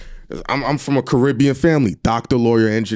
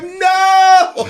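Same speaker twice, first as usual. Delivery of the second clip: high, loud mock shout of refusal; a sudden high shouted no; 5 dB louder than usual. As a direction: yell it out in protest. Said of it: "I was like".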